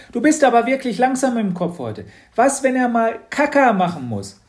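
put-on high, loud girl's voice; a bright, bossy little girl; Mathilda's voice. Mathilda teases Matz. (hohe, laute Mädchenstimme)

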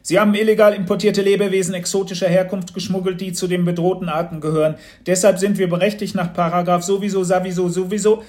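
fast, loud, high-pitched official patter; officious customs officer. A narrator's reading. Officer accusing a sleepy father, rattled-off officialese. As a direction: rattle off the regulations by rote.

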